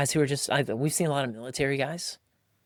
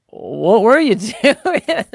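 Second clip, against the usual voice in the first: high, loud, slow, laughing through the words; can't hold the laugh asking it; incredulous laughing question; 9 semitones higher, 11 dB louder, much slower than usual. Retold or imitated. Laughing at dad's story.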